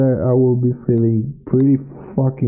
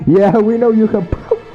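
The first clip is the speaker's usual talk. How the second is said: hammy line read, pitched up; bad acting; 8 semitones higher than usual. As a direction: overplay the line like a bad actor. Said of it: "you deliver it like".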